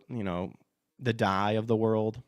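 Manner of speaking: ordinary talk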